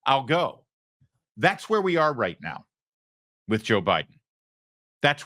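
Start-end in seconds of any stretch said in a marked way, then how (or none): 0.0-0.7 grudging give-in. Retold or imitated. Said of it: "Wouldn't you say".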